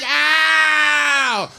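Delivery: sharp loud high "ow"; shrill-free pained yelp, loud. Retold or imitated. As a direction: yelp like she's been hit. Wife yelps in comic bit.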